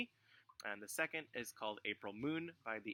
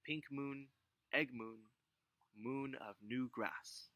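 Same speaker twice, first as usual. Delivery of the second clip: poem recitation; 2 semitones higher than usual. Acted. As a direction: recite it line by line, unhurried. Reads Kathy Song poem.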